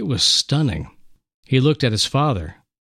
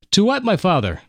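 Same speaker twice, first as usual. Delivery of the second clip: put-on young boy voice; 8 semitones higher, faster than usual.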